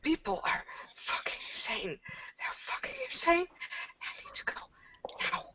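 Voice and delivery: hissed panicked whisper; whispered alarm; frightened whisper. Acted. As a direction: whisper to yourself in rising panic. Panicked muttering to herself.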